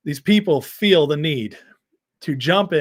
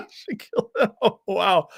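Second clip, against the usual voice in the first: laughing; 7 semitones higher than usual. Real, not acted. Mocking chat comment, laughing.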